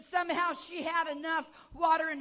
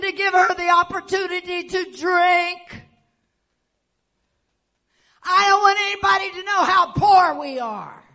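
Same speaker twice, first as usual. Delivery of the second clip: retold rebuke; 3 semitones higher, 12 dB louder than usual. Confronting the father, shouting.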